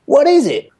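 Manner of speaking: high, loud exclaimed question; Cat impression. Fan quoting Cat's repeated line, high loud impression. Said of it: "he also does it in the Stasis League episode".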